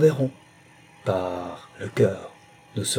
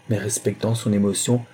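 usual speaking voice

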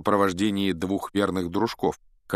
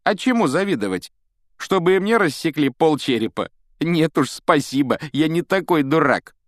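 high, loud, sneering schoolboy; scornful mockery; 9 semitones higher, 6 dB louder than usual. A narrator's reading. Malfoy scoffs at envying Potter's scar, loud and derisive. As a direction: pour scorn on the idea.

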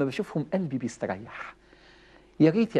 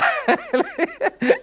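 high burst of laughter. Real, not acted. Laughing about the maddening son.

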